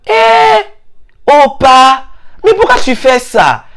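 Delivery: high outraged cry then reproach; shocked scolding. Retold or imitated. The teller outraged at the friend's new accent, high cry. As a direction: yelp in disbelief at him.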